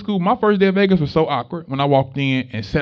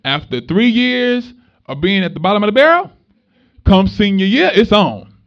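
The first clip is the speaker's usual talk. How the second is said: building hype; 5 semitones higher, 7 dB louder than usual. Real, not acted. Hyping senior year to students.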